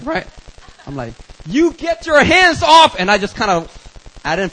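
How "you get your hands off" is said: loud, high, drawn-out shout; acted tough-guy yell; angry challenge. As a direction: roar a slow warning at him. Challenges bouncer grabbing friend.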